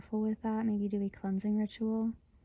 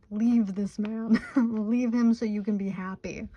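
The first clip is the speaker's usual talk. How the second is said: slow, louder quote through laughter; laughing quote; 5 dB louder than usual. Retold or imitated. Guides telling the client, quoted while laughing. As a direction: voice the guides, giggling. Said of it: "They're literally saying".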